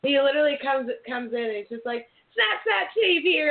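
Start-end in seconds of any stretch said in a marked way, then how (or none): 2.3-3.5 high-pitched, slow imitation of a comic alter ego; Snapchat Steve. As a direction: attempt a friend's silly character greeting, high and drawn out. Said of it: "I can't do it as good as you".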